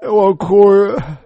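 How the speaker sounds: 放慢，带着哭腔说出; 讲到这里忍不住哭了出来; 真实哭泣. Real, not acted. (回忆丧子之痛，哽咽着说)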